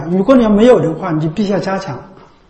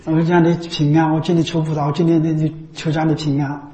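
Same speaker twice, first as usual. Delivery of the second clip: 1 murmured inner prayer; 3 semitones lower, 6 dB quieter than usual. Retold or imitated. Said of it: "因为个个都在想着".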